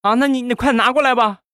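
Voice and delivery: high-pitched wifely voice; high, put-on wife voice; wife's voice. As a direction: pitch it way up as the wife talking to her husband. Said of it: "媳妇儿说话了".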